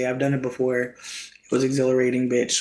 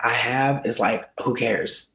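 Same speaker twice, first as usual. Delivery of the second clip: normal talking voice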